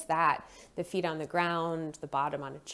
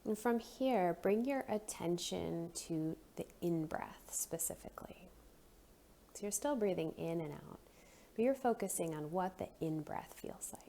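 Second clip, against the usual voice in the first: soft, unhurried meditation voice with long pauses; guided breathing meditation; 9 dB quieter than usual. Real, not acted. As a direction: gently guide them into noticing the breath.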